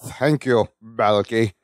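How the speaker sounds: low, labored bad-actor voice; deep, forced delivery. Larry turns to camera for his line, deep and forced. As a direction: force it out deep and over-modulated. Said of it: "in this weird, deep, forced, labored line delivery".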